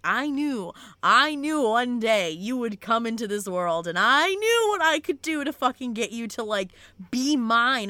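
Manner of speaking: hammed-up possessive villain; vicious villain. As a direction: stretch each phrase with menacing relish. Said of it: "how vicious she is, where she's like".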